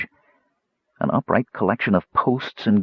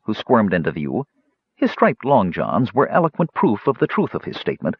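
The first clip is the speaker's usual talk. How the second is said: ordinary delivery, nothing marked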